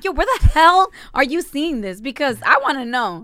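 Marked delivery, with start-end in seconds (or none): 0.0-2.0 higher and louder, half-laughing disbelief; a mother demanding where her son saw this; loud shocked demand. Retold or imitated. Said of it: "that's why I'm like".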